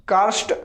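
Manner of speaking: "कार्स्ट" with half r and half s, full t at the end; "कार्स्ट" as kaa-r-s-t, ending in a full t; careful pronunciation. Shown as a demonstration. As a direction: sound every part of "कार्स्ट": kaa, r, s, t. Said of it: "ऐसे बोलिए".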